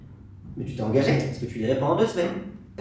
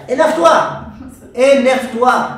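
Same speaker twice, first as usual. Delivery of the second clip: loud urging; 5 semitones higher, 8 dB louder, slower than usual. Acted. Urging to get angry.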